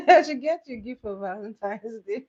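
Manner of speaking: amused, giggly delivery; laughing. Real, not acted. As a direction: laugh your way through the line. Laughing at a viewer's chat.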